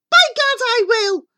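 high, loud mother's outburst; defiant cry. A narrator's reading. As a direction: cry it out with fierce resolve. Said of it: "The mother cried".

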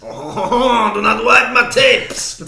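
high, slow, hammed-up French accent; silly French accent through a laugh; mock French accent. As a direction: camp it up as a Frenchman. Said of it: "in a French accent".

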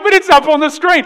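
high, loud mock complaint; teasing the congregation with a loud whiny complaint; mock whiny protest. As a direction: whine it like a caught-out listener. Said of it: "You're like".

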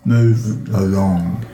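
low, slow and flat, closing the talk; curt dismissal. Acted. Guard waving a stranger away, a slow low brush-off. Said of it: "So she says".

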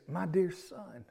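a hint of a tender father. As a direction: gently draw the son close. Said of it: "a term of endearment".

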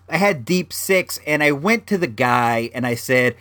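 usual speaking voice

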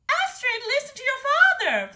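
very high, loud desperate plea; pleading queen. A narrator's reading. Mother begging the daughter to obey, pleading. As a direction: plead frantically with your child. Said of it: "pleaded the queen".

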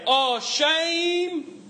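"Oh, shame" swooping way up high, drawn out; New Zealand "Oh, shame". Shown as a demonstration. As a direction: sing out "Oh, shame" in a high mocking lilt. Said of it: "you can literally say".

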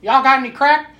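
high, loud crackhead voice; Dave Chappelle bit. Retold or imitated. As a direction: blurt it in the tense moment for a laugh. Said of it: "the Dave Chappelle".

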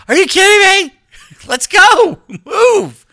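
high, loud screech of disbelief; hammed-up shouted order, voice shooting way up; exasperated yell. Husband hurrying his wife to get moving, exasperated yell.

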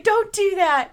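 hammed-up scolding; mock scolding shout. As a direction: bark it like a rattled husband. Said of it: "He's like".

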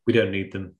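usual speaking voice

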